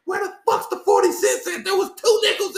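high imitation of an irate customer demanding her forty cents; angry woman at drive-thru. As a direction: squeal it like a customer losing it over change. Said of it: "And she's like".

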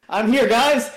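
high, loud mock-triumphant announcement; cheerful arrival. Player acting out his oblivious return to friends, cheerful arrival. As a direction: burst in calling brightly to the group.

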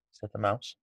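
ordinary delivery

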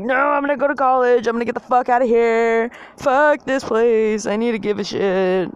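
high, loud self-rallying outburst; mock-defiant rallying cry; worked-up determination.

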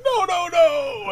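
high, slow drawn-out no no no; a kid watching the balloon fall; panicked kid. Retold or imitated. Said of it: "You're like".

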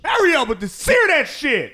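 high, loud mock chef yell; mock kitchen screaming. Imitating a chef screaming at cooks, loud and high. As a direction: yell like a furious head chef. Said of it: "screaming at motherfuckers".

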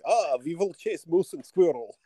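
high cartoon Russian villain accent; Russell Crowe's Russian accent. Waiting for Kraven's dad to say it, cartoon Russian accent. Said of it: "listening to his accent and I'm waiting for him".

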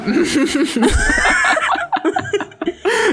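big shrieking laugh, high. Real, not acted. Risa tras chiste. (carcajada fuerte y aguda)

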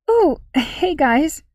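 rusty, croaking from disuse; croaky voice. Acted. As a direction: croak it out slowly, voice unused. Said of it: "her voice croaked from disuse".

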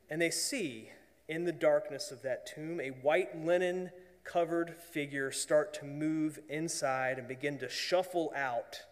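usual speaking voice

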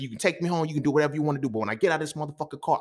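high-pitched, heated vow; retold earnest threat. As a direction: swear revenge, worked up and meaning it. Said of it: "I meant that shit".

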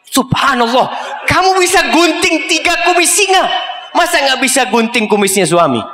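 a sheikh marvelling, half laughing at her; amazed sheikh. Acted. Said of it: "Itu syekhnya heran". (syekh terheran-heran sambil tertawa, suara jauh lebih tinggi)

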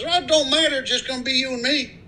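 high-pitched voice for the friendly country neighbor. Acted. Guy in pickup greeting new neighbor, high-pitched friendly voice.

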